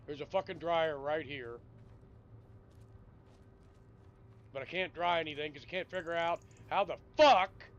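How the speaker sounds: loud, rising rant at the game; frustrated yell. Real, not acted. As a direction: blow up in real annoyance. Gamer raging at the dryer.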